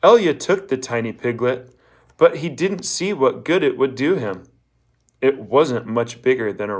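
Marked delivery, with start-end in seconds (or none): none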